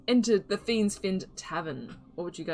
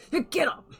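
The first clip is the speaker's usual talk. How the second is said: a sharp, much higher call; shouted wake-up; 11 semitones higher, 3 dB louder than usual. Acted. Barkeep waking the passed-out sailor at the bar, with a high sharp shout. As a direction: yell him awake. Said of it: "and he's like".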